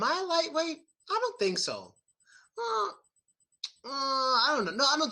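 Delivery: high, playful mock dithering; mock hesitant. Vlogger asks himself if he's a lightweight, mock unsure. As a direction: feign doubt about being a lightweight.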